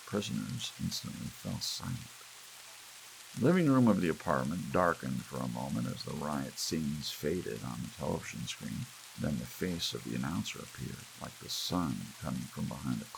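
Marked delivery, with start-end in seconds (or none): none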